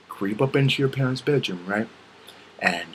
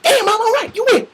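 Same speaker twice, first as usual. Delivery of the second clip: very high, loud wail; yelled surrender; 9 dB louder than usual. Acted. Child giving in to a parent, high-pitched yell.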